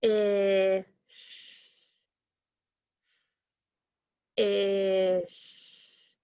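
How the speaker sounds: "Ish" as f, long held short i, long sh; sounds broken apart. Shown as a demonstration. Teacher helping pupils count sounds, broken apart. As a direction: stretch each sound of "Ish" separately. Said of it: "Let's break it apart".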